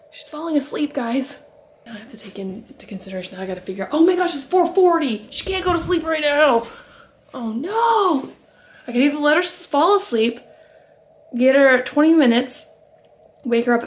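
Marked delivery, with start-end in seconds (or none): 3.9-8.3 high-pitched, mild alarm; dismayed. Real, not acted. Mother realizing baby's nap is too late, dismayed. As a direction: let the pitch jump up in dismay.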